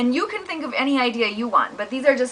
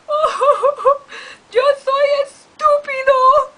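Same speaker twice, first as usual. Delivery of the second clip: much higher, louder crying; sobbing kitten; 6 dB louder, slower than usual. Acted. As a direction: wail it like a kitten. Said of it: "we would sound like this".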